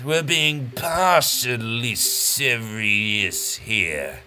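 very slow, every syllable accented; slow weighted delivery. Real, not acted. Host explaining his slow countdown, slow weighted grandeur. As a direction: drag each word out with epic grandeur. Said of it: "for I speak slowly, with weighted, accented words".

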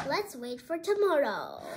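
plain reading voice

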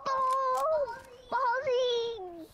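high excited yell; excited cheer. Real, not acted. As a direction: cheer it out happily. Kid cheering in game.

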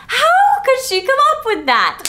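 high, loud indignant squeal; mock outraged high voice; hammed-up outrage. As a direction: squeal it like an offended diva. Edward outraged, exaggerated.

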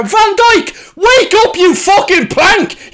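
high furious yell at the telly; angry shout. Real, not acted. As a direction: bawl at him to wake up. Yelling at defender on screen.

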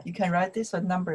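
ordinary delivery

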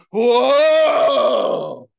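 long, high, loud whoa; awed shout. Acted. Concertgoer riding the music at a live show, awed shout. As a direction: draw it out into a big acted holler. Acting out live ride reaction.

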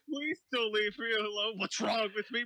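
normal talking voice

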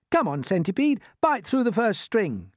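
put-on high-pitched, urgent boy's command; James giving an order. A narrator's reading. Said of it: "James ordered".

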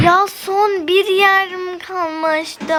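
dramatic slow-motion dismay. Real, not acted. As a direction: stretch it out in disbelief. Shocked slowed outburst in game.

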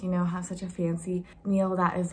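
usual speaking voice

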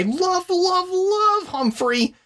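high slow squeaky hamster voice. Shown as a demonstration. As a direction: stretch it out in a tiny squeak. Gushing about beloved hamster book.

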